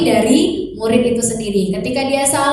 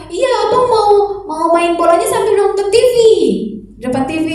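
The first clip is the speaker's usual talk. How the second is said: put-on higher little boy answering; child's voice; 8 semitones higher, 3 dB louder than usual. Son answers mother, higher child voice. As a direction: play the son explaining himself.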